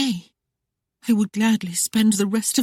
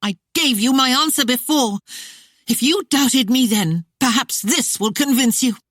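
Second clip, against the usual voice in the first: higher and louder, furious at him; angry lady; 8 semitones higher, 4 dB louder than usual. Acted. The captive lady rebuffs the sheriff, angrily. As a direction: fling the words at the sheriff. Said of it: "An angry voice came from within".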